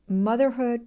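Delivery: ordinary delivery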